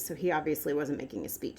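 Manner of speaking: ordinary talk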